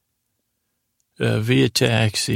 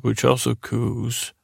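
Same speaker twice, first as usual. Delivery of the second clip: ordinary talk, nothing marked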